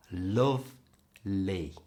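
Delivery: Lovely said very slowly, tongue stroking the teeth on each L; drawn-out Lovely with clear Ls; careful L sounds. Shown as a demonstration. Teacher modelling the L in Lovely slowly. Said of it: "To make them two L sounds in lovely".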